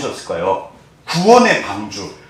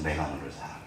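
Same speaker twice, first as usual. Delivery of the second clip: much softer and lower, a near-whispered I love you; tender hush; 12 semitones lower, 19 dB quieter, faster than usual. Acted. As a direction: breathe it out like a father to a child. God says I love you.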